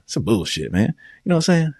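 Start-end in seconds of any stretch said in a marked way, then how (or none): 1.2-1.7 excited. Real, not acted. High exclaimed tag question.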